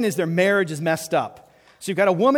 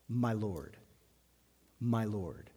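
lower, much softer "My Lord"; reverent Sarah; 7 semitones lower, 10 dB quieter, slower than usual. Retold or imitated. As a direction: breathe it with deep respect.

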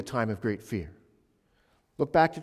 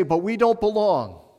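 raised, high cry of dismay; anguished cry; 9 semitones higher, 9 dB louder than usual. Acted. Unbelievers facing Jesus, crying out high and loud. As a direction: cry out that you don't belong.

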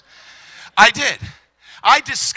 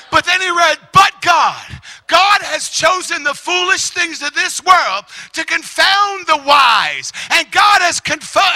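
full-force preaching; 8 semitones higher, 6 dB louder than usual. Real, not acted.

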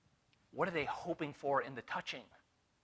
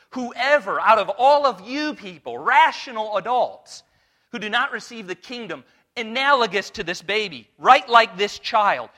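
loud, driving preacher's appeal; full-force preaching; 4 semitones higher, 14 dB louder than usual. Acted. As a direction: press the warning home to the congregation.

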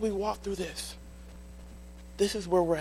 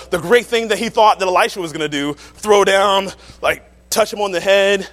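much louder, showy build; mock the big expected miracle; loud mock spectacle; hammed-up grandeur; 3 semitones higher, 14 dB louder than usual.

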